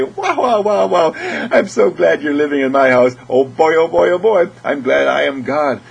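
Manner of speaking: high, loud, delighted exclaiming; gleeful gushing. Acted. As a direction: gush with wonder at your own reflection. Gushing at own reflection.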